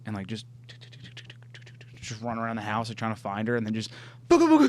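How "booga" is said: sudden high playful boo; playful scare. Acted. Dog bursting out at Holly, playful scare. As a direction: pop it out to startle.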